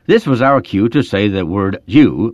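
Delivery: ordinary delivery, nothing marked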